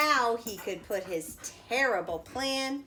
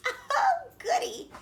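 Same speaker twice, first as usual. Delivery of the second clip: very high gleeful "goody"; delighted granny; 4 dB louder than usual. A narrator's reading. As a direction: giggle with glee. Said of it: "said Granny".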